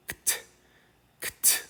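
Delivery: kt as two clicks of k and t, no vowel; soft, crisp k-t release; tough consonant blend. Shown as a demonstration. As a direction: say kt softly, just the consonants. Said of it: "That's a tough two consonants to say".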